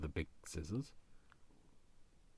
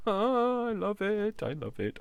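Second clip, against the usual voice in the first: very high, loud, slow squeal of love; shrieking I love it, drawn out; squealed delight; 14 semitones higher, 8 dB louder, slower than usual. Pretend. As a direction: squeal it like a thrilled fan. Voicing delight at the stamp.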